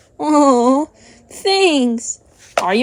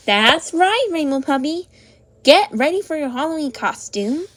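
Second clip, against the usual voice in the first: ordinary talk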